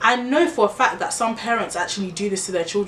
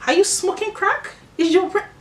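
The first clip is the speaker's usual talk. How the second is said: much higher, disbelieving retort; incredulous; 11 semitones higher than usual. Acted. Mocking critics of her view, shrill disbelief. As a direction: shoot it up in disbelief.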